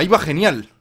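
usual speaking voice

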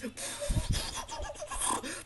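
high squealing giggle; high breathless cackle; panicky laugh. Real, not acted. Panicked flight in game.

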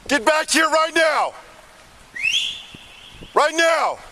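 shouting across the water, high and loud; calling from far away. Real, not acted. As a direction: yell it out at full volume to someone far off. Officer shouting at fleeing subject.

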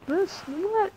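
high squeak of confusion; sudden puzzled yelp; baffled. Real, not acted. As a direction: react like the text makes no sense. Confused by game choice.

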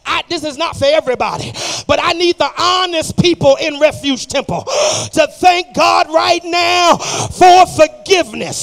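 loud, driving church exhortation; full-force preaching. Real, not acted. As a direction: shout it out to the congregation, stretching the big phrases.

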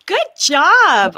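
delighted gasp of approval; gasping praise. Real, not acted. Guest hearing about the cancer fundraiser, surprised and delighted.

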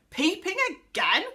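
loud, furious outburst; angry yell. A narrator's reading. Angry pixie at the window catches a peeper, yelling. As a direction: yell it out in a temper. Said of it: "He opened it and yelled loudly".

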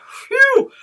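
high, loud exhaled phew; relieved phew. Acted. Dog relieved dragon has gone, loud phew.

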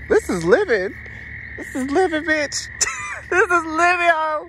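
high, loud joyful yell; loud high whoop of joy; ecstatic shouting. Real, not acted. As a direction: shout it out in pure delight. Exultant shouting in park.

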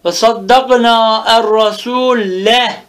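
slow chant-like reading, final "e" of "rasule" clearly sounded; drawn-out fetha ending. Shown as a demonstration. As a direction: stretch "rasule" to show its "e" ending. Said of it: "mensubun bil fethati zahira".

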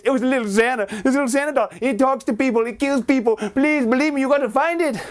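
put-on high desperate pleading child; high desperate plea; frantic kid. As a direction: beg the police to believe. Boy pleads with the police.

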